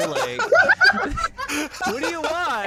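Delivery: cackling through the words; laughing. Real, not acted. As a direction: laugh while teasing. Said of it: "GM mocks player's shot laughing".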